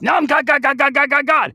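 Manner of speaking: very high, loud repeated God; soaring proclamation. Retold or imitated. Quoting God growing ever bigger, soaring. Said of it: "God keeps going up".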